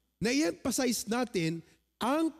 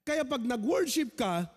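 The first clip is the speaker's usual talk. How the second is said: ordinary talk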